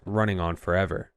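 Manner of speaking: ordinary delivery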